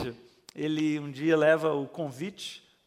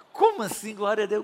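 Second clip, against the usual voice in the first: higher and louder, incredulous; shocked question; 4 semitones higher, 8 dB louder, faster than usual. Retold or imitated. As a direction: ask it as if you can't believe him. Bride shocked at his reaction. (pergunta chocada, mais alta e forte)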